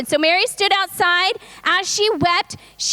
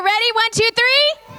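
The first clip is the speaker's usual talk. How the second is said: loud countdown; 8 semitones higher, 5 dB louder than usual. Shown as a demonstration. Teacher counting the class into a big cheer, loudly. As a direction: draw out the count to launch the cheer. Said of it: "Do a big cheer".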